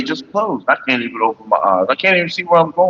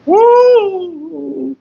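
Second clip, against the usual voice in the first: high celebratory woo. Real, not acted. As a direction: whoop it out up high. Celebrating after hit.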